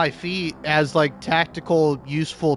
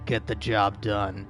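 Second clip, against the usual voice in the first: dramatic reading; 5 semitones lower, 4 dB quieter than usual. Acted. Reads ruthless backstory lower.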